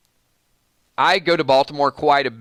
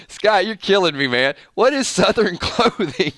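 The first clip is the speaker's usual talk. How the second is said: higher voice, cracking up while talking; laughing; 5 semitones higher than usual. Real, not acted. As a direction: talk through real laughter. Cracked up by viewer's comment.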